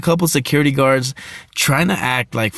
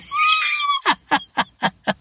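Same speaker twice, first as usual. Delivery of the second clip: loses it laughing; shrieking laugh; 3 dB quieter than usual. Real, not acted. Laughing hard while recalling the partners fighting.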